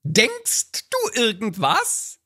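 hoch und langsam bohrend; nörgelnde Ehefrau. Acted. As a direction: misstrauisch nachfragen. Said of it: "so ein Lieblingstext". (Ehefrau fragt hoch und langsam nach)

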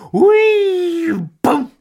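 torpedo boom. Acted. Mimics a torpedo explosion.

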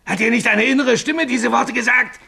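desperate questioning. Acted. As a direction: press him frantically for the truth. Desperate questioning of his friend. (verzweifelt drängend, immer lauter)

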